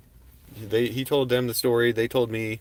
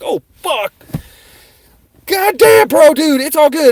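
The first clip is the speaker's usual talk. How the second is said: high, loud frantic pleading; begging him to calm down, very high and loud; panicked; 14 dB louder, slower than usual. Retold or imitated. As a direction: voice cracking up in panic at the gun. Gun pulled on him.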